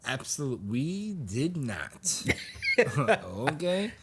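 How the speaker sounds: high, loud, drawn-out mock protest; hammed-up denial. Accused character blaming her, hammed-up protest. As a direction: protest innocence, stretching it out into laughter.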